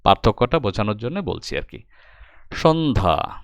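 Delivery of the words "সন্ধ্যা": সন্ধ্যা with an initial "sh": shon-dha; pronunciation demo. Shown as a demonstration. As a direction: say সন্ধ্যা as two careful beats, shon-dha. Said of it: "স এর মতো উচ্চারণ করি".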